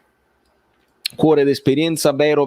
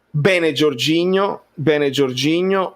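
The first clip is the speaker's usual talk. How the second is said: normal talking voice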